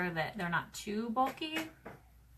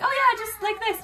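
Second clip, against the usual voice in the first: high, louder quoted reply; retold breezy agreement; 11 semitones higher, 6 dB louder than usual. Retelling her answer to the friend, breezy agreement. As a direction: act out an eager yes. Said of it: "And I was like".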